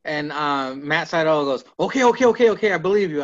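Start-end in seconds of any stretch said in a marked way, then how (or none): 1.8-3.2 hurried giving in. Retold or imitated. Retold giving in to Cabana's claim.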